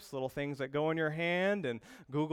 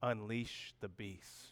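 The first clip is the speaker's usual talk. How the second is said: very slow, lower and much softer, menacing; ominous slogan; 5 semitones lower, 9 dB quieter, much slower than usual. Retold or imitated. Pastor reading the energy drink slogan as a sinister omen. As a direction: growl it like a movie trailer villain. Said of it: "it says".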